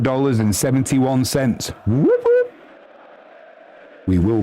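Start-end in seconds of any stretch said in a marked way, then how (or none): 1.9-2.5 very high-pitched little whooping; celebratory whoop. Real, not acted. Streamer thanking a donor, high celebratory whoop. Cheers a viewer's money donation.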